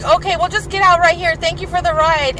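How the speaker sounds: high, urgent politeness. Retold or imitated. Child hastily leaving stranger's car.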